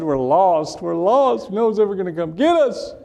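high-pitched, hammed-up tearful wail; mock crying. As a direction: imitate a lost kid bawling. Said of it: "you know, he's crying".

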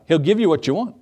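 ordinary talk, nothing marked

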